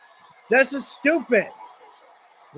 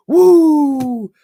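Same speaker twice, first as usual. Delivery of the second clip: a loud, high Ric Flair woo; 6 semitones higher, 6 dB louder than usual. Retold or imitated. Ranting streamer mocks Ric Flair's daughter's push, loud Flair woo. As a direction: belt out the woo like the Nature Boy. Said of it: "she's the daughter of Ric Flair".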